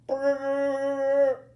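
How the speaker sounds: loud, high trilled school bell; ringing bell noise. A narrator's reading. School bell rings and saves Billy, imitated trill. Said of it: "the bell rings".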